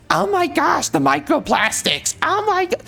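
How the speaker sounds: hammed-up high-pitched panic about microplastics; mock high fretful woman's voice; mocking Poison Ivy. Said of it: "she says".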